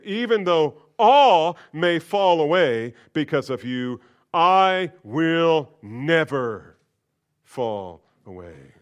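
drawn-out loud pledge; bold vow. Acted. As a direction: declare it fearlessly.

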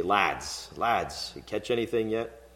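a mild British lads greeting; British accent. Retold or imitated. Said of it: "if we had a British accent".

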